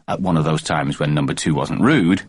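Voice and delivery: usual speaking voice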